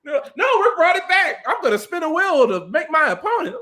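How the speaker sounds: mock it in a high voice; mocking incredulity. Co-host ridiculing the wheel announcement, high mocking.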